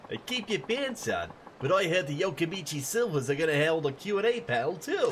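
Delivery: high-pitched fan sharing hot gossip; lively street punk with a rumor; second punk fan. Acted. Voices second fan in game.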